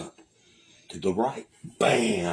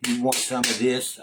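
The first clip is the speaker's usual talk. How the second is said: taunting coworker; 6 semitones higher, 3 dB louder than usual. Retold or imitated. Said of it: "He come over there and he went".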